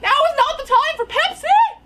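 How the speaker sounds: high, loud squawk of disbelief; hammed-up exasperated shriek; exasperated yell. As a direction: scold a friend in mock panic. Retold exasperated yell at friend.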